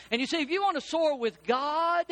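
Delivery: high, building, full-force preaching; preaching climax. Acted. Pastor urging his flock toward the Spirit, high and building. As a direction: lift them up to the final line.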